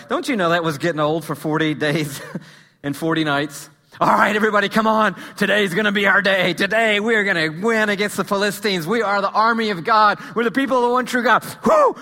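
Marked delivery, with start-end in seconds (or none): 4.0-11.4 preacher hamming up a soldier's pep talk; rallying war speech. Acted. Rallying the army before battle. 11.6-11.9 high, loud shouted whoop; piercing war cry. Acted.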